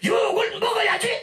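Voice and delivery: 아주 높은 목소리로 내지르는 고함; 따지듯 새된 소리로 외치기; 고함치는 군중 흉내. Acted. 욕하는 사람들의 대답 흉내. (욕하는 사람 흉내로 버럭 고함)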